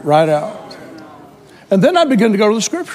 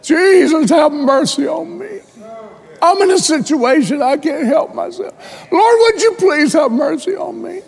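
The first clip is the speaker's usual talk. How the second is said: pleading cry; 9 semitones higher than usual. Retold or imitated. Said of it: "You know what he said?".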